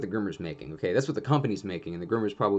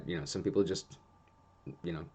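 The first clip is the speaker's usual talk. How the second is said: usual speaking voice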